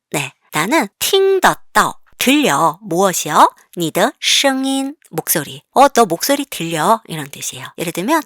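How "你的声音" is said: ordinary talk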